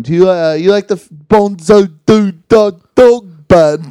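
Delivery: mock Sheffield accent. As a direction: loudly imitate a broad Sheffield accent. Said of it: "we were just going up to people going".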